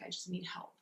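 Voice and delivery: a very soft, hushed plea for help; quiet plea. Retold or imitated. Praying alone behind the store, a hushed plea. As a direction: murmur it like a private prayer.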